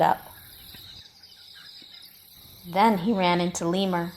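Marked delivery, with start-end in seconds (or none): none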